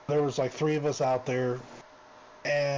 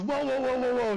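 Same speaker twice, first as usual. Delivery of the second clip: high, hurried whoas of backing down; mock panic; 10 semitones higher than usual. Detainee backing off as the sergeant charges, mock panic. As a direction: play the guy suddenly scared and stalling.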